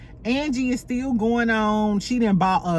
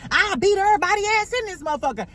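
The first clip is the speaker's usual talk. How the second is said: mock hyped threat; 10 semitones higher, much faster than usual. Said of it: "Angie was like".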